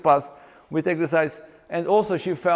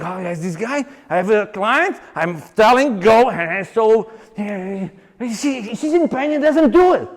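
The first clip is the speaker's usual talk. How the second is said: mock expert; 4 semitones higher, 4 dB louder than usual. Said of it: "lots of experts saying".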